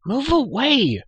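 high, loud urgent call; cried out. A narrator's reading. Hidden girl calls to the prisoner from under the straw, crying out. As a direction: call out sharply from below, pitch leaping up. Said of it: "cried a faint voice".

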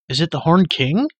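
high, loud frightened cry; startled boy crying out in the dark; alarmed cry. A narrator's reading. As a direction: blurt it out in sudden alarm. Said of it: "cried Terran".

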